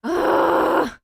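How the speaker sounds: loud, high groan of real frustration; frustrated groan. Real, not acted. Venting at the broken recording, loud groan. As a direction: let out an exasperated ugh.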